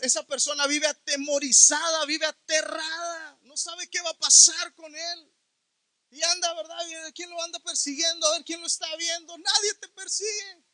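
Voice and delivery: high, quick pulpit shouting; heated preaching. Acted. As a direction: drive the fear home from the pulpit. Heated sermon climax.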